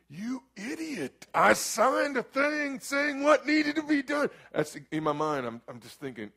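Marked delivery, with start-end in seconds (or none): 0.1-4.3 high, mocking furious inner voice; inner angry rant. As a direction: spit it out like a scream in your head. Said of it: "In my mind, I'm going".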